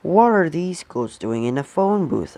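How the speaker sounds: ordinary delivery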